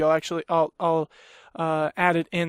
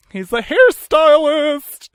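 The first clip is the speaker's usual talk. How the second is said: much higher and louder, slow, blowing out the mic; over-the-top barber impression; 9 semitones higher, 9 dB louder, slower than usual. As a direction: belt it out like a showy hairstylist. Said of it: "too involved in my impression".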